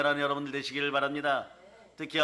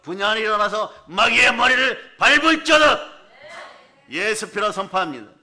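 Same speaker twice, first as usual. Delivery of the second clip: higher and louder, building to a roar; roaring rallying speech; 4 semitones higher, 7 dB louder than usual. Acted. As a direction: rouse the congregation to battle. Preacher rousing the congregation.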